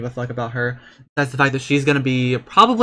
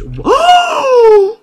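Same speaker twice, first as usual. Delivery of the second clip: shrieking gasp; 11 dB louder than usual. Real, not acted. Baby coming shriek.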